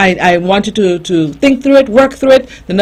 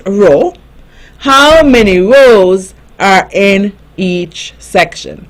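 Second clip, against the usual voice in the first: reading slowly; slower than usual. Shown as a demonstration. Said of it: "I'm going to read it slowly".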